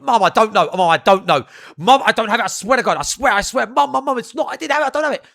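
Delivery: panicked pleading. Retold or imitated. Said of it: "I'm like".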